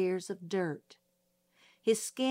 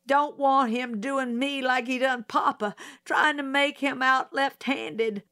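put-on a touch higher, anxious country girl; blurting her fear a little higher; mild frightened girl; 6 semitones higher, 4 dB louder than usual. Frightened witness to the judge, lightly higher voice.

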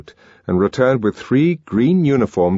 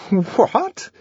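high, startled "What?"; astonished; 14 semitones higher than usual. A narrator's reading. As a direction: blurt it out in shock. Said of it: "said Helmholtz in astonishment".

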